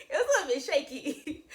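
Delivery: shaky laughing. Real, not acted. Catching herself getting emotional, laughing it off. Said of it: "Did I just get emotional?".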